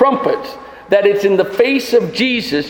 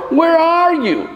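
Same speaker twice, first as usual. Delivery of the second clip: higher, slow frightened call; a child's slow, high call for a parent; lost child calling; 8 semitones higher than usual. Retold or imitated. Lost child crying in woods.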